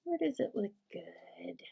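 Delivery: slow whispered musing; hushed thinking aloud; whisper to self. Real, not acted. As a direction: whisper the question to yourself. Musing to herself.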